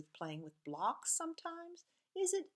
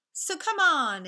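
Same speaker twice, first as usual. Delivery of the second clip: excited; 9 semitones higher, 14 dB louder, slower than usual. Acted. Story invites readers along.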